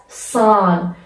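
سان said slowly with a long آ; stretched aa. Shown as a demonstration. Teacher repeating سان with a long vowel. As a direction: hold the آ in سان.

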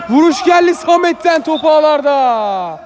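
excited goal call. Real, not acted. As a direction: yell the goal and draw out the end. Goal called in live commentary.

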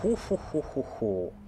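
drawn-out spooky huhuhu; mock spooky hooting; playful ghostly hoot; slow mock ooh. Real, not acted. Reacting to enemy spell.

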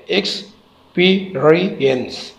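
every syllable of "experience" set apart. Shown as a demonstration. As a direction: count out four beats in "experience". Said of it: "चार syllable है".

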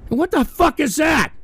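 high, loud yelled question; outraged shout. Real, not acted. Streamer yelling at trolls' fan art, outraged. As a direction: yell it at the screen.

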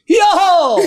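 high, loud highland yodel call; highlander whoop. Retold or imitated. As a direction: let out a mountain shepherd's whoop. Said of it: "góral śpiewa dziecku kołysankę". (głośny, wysoki góralski okrzyk)

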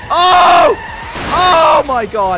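high, loud, drawn-out cry; screaming amazement. Real, not acted. Gamer watching the semi-truck shoot off, screaming amazement. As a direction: scream it in awe.

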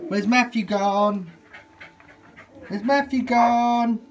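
slow, high, loud sing-song; peekaboo voice. Real, not acted. Peekaboo with the baby.

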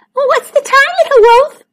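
high squeaky chant; high-pitched game call; little animals. A narrator's reading. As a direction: call out like playful mice. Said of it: "they wiggled".

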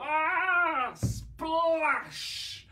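high, loud mock shriek; playful splash cry. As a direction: tumble into the water with a squeal. Pretend falling into water.

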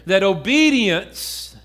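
ordinary talk

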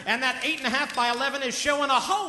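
sports commentator calling the action; play-by-play commentary. Acted. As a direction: call the writing like a fight. Play-by-play of writing as boxing.

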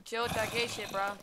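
usual speaking voice

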